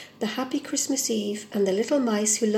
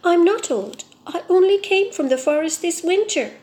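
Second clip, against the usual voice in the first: raised, higher insistent protest; indignant; 7 semitones higher, 5 dB louder than usual. Acted. Tree insists to the mice he is young, indignant. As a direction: insist hotly on your youth. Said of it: "said the fir tree indignantly".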